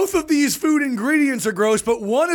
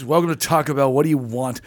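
surly clerk; 9 semitones lower, faster than usual. Retold or imitated. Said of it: "he goes".

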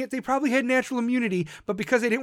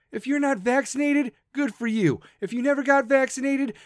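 ordinary talk

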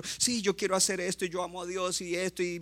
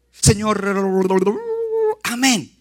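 mock quick prayer; 5 semitones higher, 9 dB louder, slower than usual. Mocking a rushed prayer.

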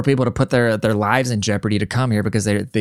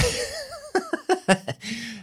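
high cackling laugh; 7 dB quieter than usual. Real, not acted. Host hearing the politician's claim, bursting into high laughter. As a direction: crack up at something absurd. Reacting to the clip.

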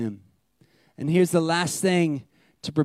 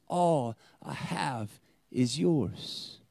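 slow, soft and lower; hushed loving promise; tender father; 5 semitones lower, 7 dB quieter, much slower than usual. Retold or imitated. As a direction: let each word land gently. The father's heart, quoted.

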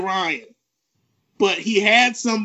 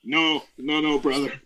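Hulk Hogan impression; 6 semitones lower, faster than usual. Podcast host imitating Hulk Hogan refusing a flashy move, gruff and low. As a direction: sound like an old-school wrestling legend turning someone down. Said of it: "What would Hulk Hogan say?".

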